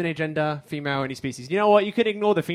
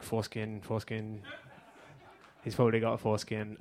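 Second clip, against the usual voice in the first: mock muttered thought; 4 semitones lower, 8 dB quieter than usual. Audience's imagined thoughts, muttered.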